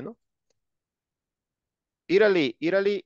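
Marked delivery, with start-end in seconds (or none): 2.1-3.0 "Italy, Italy" said in English, IT-a-li, higher; teacher drills "Italy" in English; English pronunciation. Shown as a demonstration. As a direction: repeat "Italy" the English way for the class.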